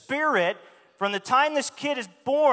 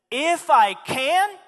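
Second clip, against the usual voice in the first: higher, louder, slow "If I can?" in disbelief; mock-incredulous Jesus; 5 semitones higher, 5 dB louder, much slower than usual. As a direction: throw the question back, astonished. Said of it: "Is that where you're coming from?".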